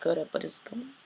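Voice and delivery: low-key, softly voiced mock suspicion; mock suspicious. Imitating Bonnie in the witchy house, softly suspicious. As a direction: imitate someone quietly suspicious. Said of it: "all suspicious".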